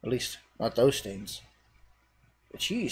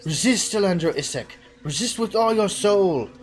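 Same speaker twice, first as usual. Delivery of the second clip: higher, louder urgent plea; sweet voice; 6 semitones higher, 5 dB louder than usual. Acted. Friend's voice to the hero, higher urgent plea. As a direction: call him back from the brink. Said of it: "The sweeter voice".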